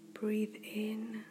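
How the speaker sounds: ordinary talk, nothing marked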